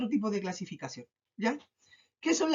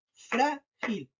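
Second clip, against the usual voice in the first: "Frágil" split into FRÁ, then gil; syllable by syllable. Shown as a demonstration. Teacher breaking up the word for pupils, stress on FRÁ of "Frágil". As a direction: sound out "Frágil" with weight on FRÁ. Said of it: "se carga en".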